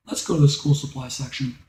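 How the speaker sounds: just steer him along, no scolding; calm mom. Acted. Mom calmly redirecting her son to school supplies. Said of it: "she wasn't angry".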